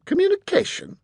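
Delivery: high, incredulous scoff; scornful questioning echo. A narrator's reading. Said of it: "scoffed young Master Fowl".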